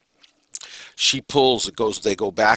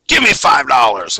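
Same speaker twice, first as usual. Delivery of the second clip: loud and higher, with a lot of power; loud, forceful demand; 5 semitones higher, 10 dB louder than usual. Shown as a demonstration. Panhandler intimidating somebody for money, loud forceful demand. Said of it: "If they say it loud".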